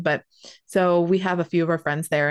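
usual speaking voice

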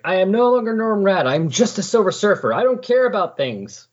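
high-pitched petulant sulk, mock-childish declaration. Podcaster mocks the hero disowning his old name, childish high whine. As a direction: whine it like a pouting teenager renouncing everything. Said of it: "he gets a little childish about it".

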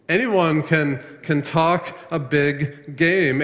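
stretched out, ANYONE stressed; preacher stretches the point slowly; slow emphatic repeat. Acted. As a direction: drag it out to land it.

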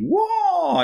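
sudden high, loud kung fu whoa; Bruce Lee yell. Retold or imitated. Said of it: "very Bruce Lee kinda thing".

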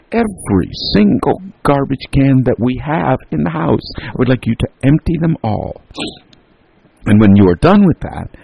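high, punched-out insistence; emphatic order. A narrator's reading. Mother orders boys to empty every can, emphatic.

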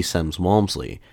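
ordinary delivery

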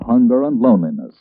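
normal talking voice

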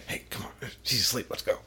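whispered nudge to come along; hushed coaxing. Retold or imitated. Partner coaxing while she's asleep, whispered. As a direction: whisper so the sleeper won't wake.

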